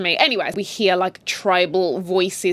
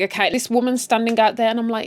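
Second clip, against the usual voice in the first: ordinary talk, nothing marked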